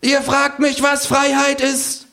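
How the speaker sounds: high, drawn-out proclamation; kangaroo proclaiming. A narrator's reading. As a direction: cry it out like a speech from the bar. Kangaroo speech.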